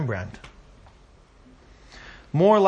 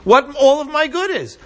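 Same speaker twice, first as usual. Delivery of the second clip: high, loud, emphatic preaching at full force; impassioned preaching; 11 semitones higher, 6 dB louder than usual. Acted. Teacher voicing God's promise to Moses, impassioned preaching. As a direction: drive each phrase home, high and loud.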